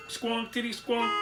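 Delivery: high, honking monotone; rhythmic horn blasts on one high pitch; tooting taxi horn. A narrator's reading. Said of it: "tooted a taxi horn".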